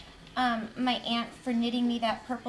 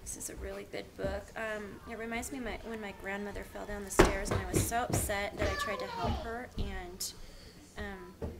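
low, soft, absent-minded rambling; hushed pretend calm; 3 semitones lower, 6 dB quieter than usual. Pretending not to notice something strange, hushed rambling. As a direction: talk softly as if nothing is odd.

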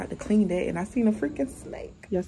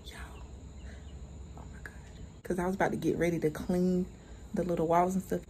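whispering; 3 semitones lower, 9 dB quieter, faster than usual. Real, not acted. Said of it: "I'm trying to be quiet because I got a neighbor".

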